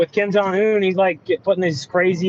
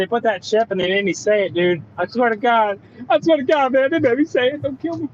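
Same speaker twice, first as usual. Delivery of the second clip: rising, pleading mock panic; higher pitched fake terror, pleading not to be killed; 7 semitones higher than usual. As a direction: plead high like a framed man begging. Pretends the FBI made him.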